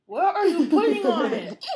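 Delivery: high, loud laughing outcry; laughing shout. Real, not acted. Sees something added.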